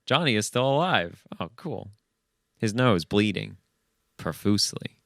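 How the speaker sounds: ordinary talk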